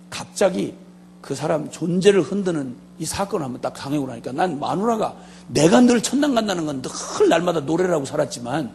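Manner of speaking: ordinary talk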